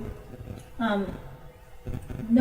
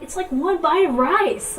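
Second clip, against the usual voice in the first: high, loud and slow, replaying her own outburst; indignant retold protest; upset protest; 9 semitones higher, 8 dB louder, slower than usual. To her friend over leftover rice, an upset, high, loud protest. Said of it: "I was so upset".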